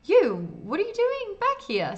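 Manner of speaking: high, loud outburst of disbelief; startled confrontation. A narrator's reading. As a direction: pitch leaps up on a sharp challenge. Clerk confronts player in story.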